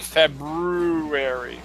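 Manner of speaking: February very slow with a long rolled-out BROO; Tony. Retold or imitated. Host echoing co-host, hugely stretched BROO in February. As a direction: drag "broo" through February. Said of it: "as Tony said".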